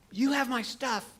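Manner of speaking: high shout at the thief; yelling. Retold or imitated. Missionary yelling at the thief who took his phone. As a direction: yell it after him across the yard. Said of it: "I'm yelling at him".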